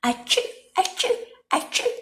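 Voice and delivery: squeaky piggy sneezes; high sneezes. A narrator's reading. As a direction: sneeze three times like tiny pigs. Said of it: "sneezed the three little pigs".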